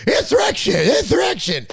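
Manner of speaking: hammed-up panic, poking fun at the word; mock alarm. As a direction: parrot it in exaggerated alarm. Mocking the alarm over January sixth.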